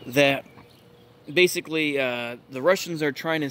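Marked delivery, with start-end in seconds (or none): none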